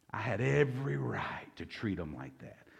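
ordinary talk, nothing marked

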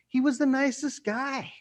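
very high-pitched mock neighbor voice; baffled onlookers. Neighbors talking about the man who went postal, high mock disbelief. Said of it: "and the people are like".

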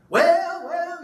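high, loud cry of "Well, well"; mock shout. Pastor imitating women shouting in church, high and loud. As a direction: call it out like a stirred-up woman in the pews.

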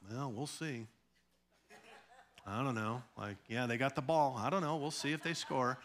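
quiet, shrugging fan voice; mock indifferent fan. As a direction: play the bored fan who can't be bothered.

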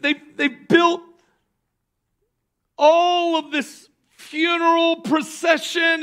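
ordinary delivery

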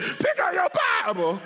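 high hollered command; comic preacher's holler; emphatic shout. Real, not acted. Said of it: "say it in such a way you won't forget".

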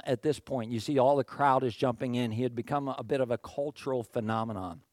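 ordinary talk, nothing marked

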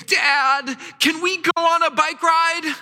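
high eager kid voice, imitating his child; child asking. Pastor imitates his child asking for a bike ride, high and eager. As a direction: play the little kid begging for the bike ride.

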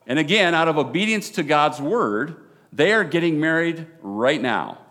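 loud, fervent wedding sermon; preaching. Real, not acted. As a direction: preach it with full conviction.

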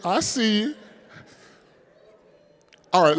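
ordinary delivery

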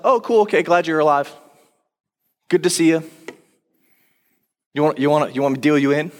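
breezy, offhand mock greeting, not awed; mock casual. Thomas greeting Jesus casually, not in awe, breezy offhand tone. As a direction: toss it off like meeting a buddy at cards. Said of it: "It wasn't like".

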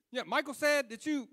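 loud, high-pitched prying; eager brother. Retold or imitated. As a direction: imitate a brother pressing loudly for gossip. Brother on phone pressing him.

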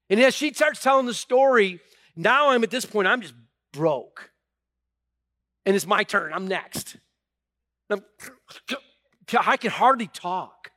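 loud, high preacher at full tilt; full-force preaching. Acted. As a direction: preach it hard to the whole church.

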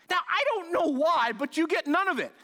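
ordinary talk

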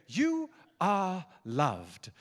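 put-on slow, drawn-out British accent; stately, stretched-out British delivery; Nicky Gumbel. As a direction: savour each word in a nice British accent. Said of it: "with a nice British accent".

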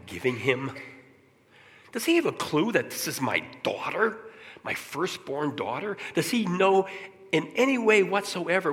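incredulous outburst, climbing to high pitch; impassioned father. Retold or imitated. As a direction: build from musing to a high, pleading protest. Acts out father's protective inner outburst.

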